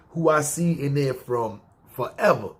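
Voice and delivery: usual speaking voice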